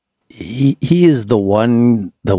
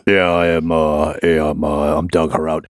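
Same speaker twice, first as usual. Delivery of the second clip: lightly lowered gravelly voice; a hint of gravel, a bit lower; Doug Heraut voice; 4 semitones lower than usual. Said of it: "I kinda have my gravelly Heraut voice".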